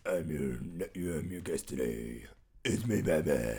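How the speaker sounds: low, throaty vigilante voice; Batman. Acted. Guest introducing himself to listeners, throaty Batman voice. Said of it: "clear your throat".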